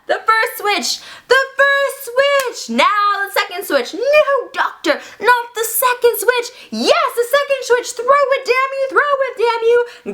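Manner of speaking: high-pitched, stretched-out mad-scientist orders; frantic commands. Acted. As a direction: cry out orders at the climax of the experiment. Doctor frantically ordering switches thrown.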